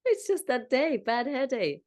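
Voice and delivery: talking through real laughter, high-pitched; real laughing. Real, not acted. Guide making light of muddled words, laughing. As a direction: let giggles break up the words. Guide cracks up during visualization.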